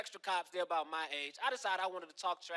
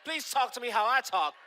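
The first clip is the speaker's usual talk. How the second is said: higher, louder indignant reply; mock indignant retort; 6 semitones higher, 5 dB louder than usual. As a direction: push back, offended and loud. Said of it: "And I said".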